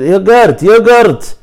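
high, loud repeated request; mock insistent calling. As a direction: imitate a customer insisting, voice pitched right up. Retold customer repeating word to clerk.

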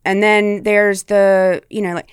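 usual speaking voice

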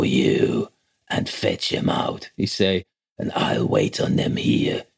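boatman imitating a strange, hushed, half-whispered speaker; curious hooded man's voice. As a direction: mimic the hooded stranger's eerie breathy murmur. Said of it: "such a curious way of speaking".

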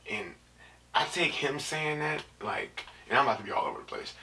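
ordinary talk, nothing marked

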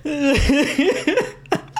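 laughing. Real, not acted. Laughing fit over a joke.

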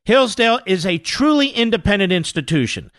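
ad read. Acted. Sponsor read.

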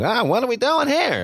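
a hint of Dave Dameshek's voice; Dave Dameshek impression. Cohost imitates a colleague at the fridge, light impression. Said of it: "this is me doing Dave Dameshek".

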